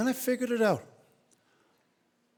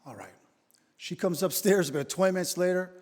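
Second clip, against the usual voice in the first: ordinary talk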